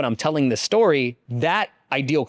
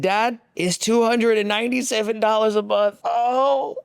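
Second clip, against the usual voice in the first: son; 9 semitones higher than usual. Retold or imitated. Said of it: "I said".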